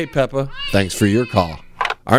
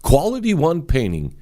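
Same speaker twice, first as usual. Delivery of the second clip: softer, earnest sponsor read; 3 semitones lower, 3 dB quieter than usual. Acted. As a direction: sell the painter like a radio ad. Host reads sponsor ad.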